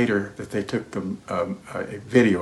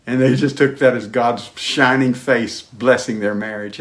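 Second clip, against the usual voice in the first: normal talking voice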